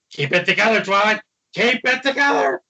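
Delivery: talking herself down as she rises; mock panic. Twilight muttering to herself by the puddle, mildly frantic.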